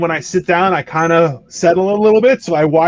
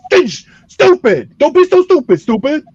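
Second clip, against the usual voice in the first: high, loud mock scolding; 10 semitones higher, 4 dB louder than usual. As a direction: scold like an exasperated parent. Scolding a stupid person, mock parent.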